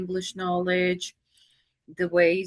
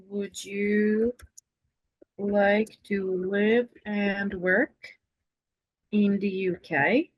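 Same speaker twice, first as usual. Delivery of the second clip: very slow, phrase by phrase; slow dictation; slower than usual. Real, not acted. Teacher helping a struggling English student, slow dictation. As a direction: spell out the question in slow, separated chunks.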